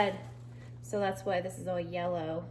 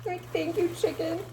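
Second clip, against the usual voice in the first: tearful thanks; 10 semitones higher than usual. Real, not acted.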